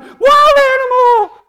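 long, high, loud shriek; hammed-up scream of runaway panic; mock panicked yell. Adults fleeing their problems, mock panicked scream.

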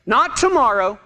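normal talking voice

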